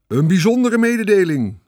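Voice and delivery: high, loud grand announcement; lofty public-address voice; pompous loudspeaker. A narrator's reading. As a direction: boom it out like a fairground announcer. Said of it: "zei de luidspreker op hoogdravende toon".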